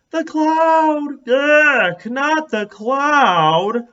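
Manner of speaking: high, loud disgusted groan, slowing down. A narrator's reading.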